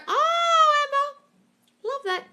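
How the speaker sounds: very high, loud gushing oh; delighted squeal. Real, not acted. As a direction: coo with delight over the news. Delighted reaction to dream-guy news.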